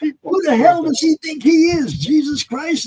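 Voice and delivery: high, loud mock outrage from the next tee; outraged disbelief. Neighbouring golfer exclaims in disbelief.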